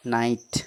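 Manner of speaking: "नाइट" as one syllable, no g; silent GH. Shown as a demonstration. Teacher repeats the answer for pupils, "नाइट" with GH silent. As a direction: model "नाइट", gliding from "ai" to t. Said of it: "इसका उच्चारण क्या हो जाएगा".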